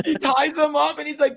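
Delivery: normal talking voice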